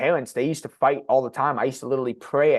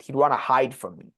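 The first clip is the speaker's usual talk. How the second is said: usual speaking voice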